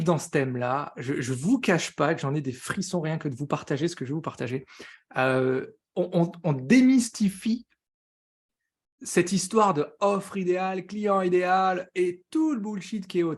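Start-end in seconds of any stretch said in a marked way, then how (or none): none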